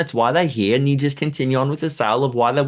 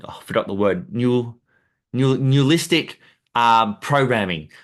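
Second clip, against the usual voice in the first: ordinary talk, nothing marked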